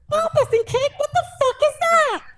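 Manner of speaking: incredulous, thin falsetto; hammed-up high, strangled outrage; squeaky pained disbelief. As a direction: squeal the question as if still in pain. Pained man still squeaking in disbelief.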